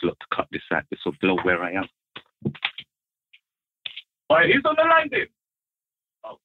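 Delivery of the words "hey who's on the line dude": calling out. Real, not acted. Calling on phone line.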